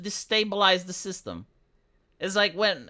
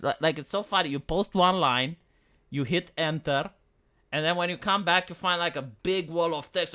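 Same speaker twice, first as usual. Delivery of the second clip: usual speaking voice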